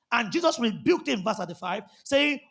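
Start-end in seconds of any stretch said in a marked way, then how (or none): none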